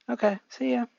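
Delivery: put-on high, bright boy voice; cheerful Izuku. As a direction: wave him off happily. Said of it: "Izuku saying goodbye".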